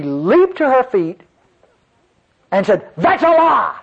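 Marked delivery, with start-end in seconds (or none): 3.0-3.8 high-pitched indignant outburst; abrupt outburst. Retold or imitated. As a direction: blurt it out, pitched way up. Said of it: "Just like that".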